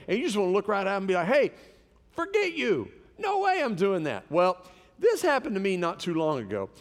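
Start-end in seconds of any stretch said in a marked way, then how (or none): 1.3-4.2 high-pitched, dismissive comeback; mock-indignant brush-off; acted refusal. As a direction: refuse outright, pitch jumping up. Acted refusal of a nutty request.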